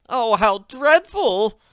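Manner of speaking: high, loud, very slow moan. Acted. Willoughby reacts to crash, slow moan. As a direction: moan in dismay, drawn out. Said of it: "Missus Willoughby moaned".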